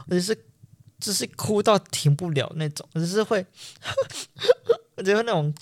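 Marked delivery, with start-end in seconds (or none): 3.8-4.9 a short, high catching sob sound; a brief demo of a sobbing catch; imitated sob. Shown as a demonstration. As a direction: act out one crying hiccup. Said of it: "就是哭会有那个".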